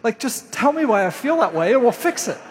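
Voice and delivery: hammed-up frustrated client; impatient whine. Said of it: "And I'm like".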